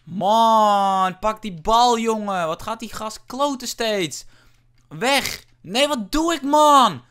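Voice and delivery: loud, high, drawn-out outbursts; real gamer rage; frustrated yelling. Real, not acted. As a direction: yell at the game in disbelief. Raging as opponent keeps dribbling.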